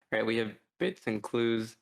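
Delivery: ordinary talk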